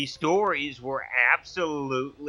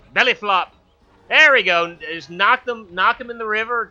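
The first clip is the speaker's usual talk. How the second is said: excited cheering; 5 semitones higher, 6 dB louder than usual. Real, not acted.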